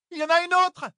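very high loud shout; alarmed cry. Acted. Kirstian shouting a warning, high and loud. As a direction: warn everyone at once. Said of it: "cria Kirstian".

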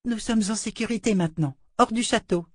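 put-on high child voice, relieved; a girl assuring her doll; relieved little girl. A narrator's reading. Rachel parle à sa poupée. (voix de fillette soulagée)

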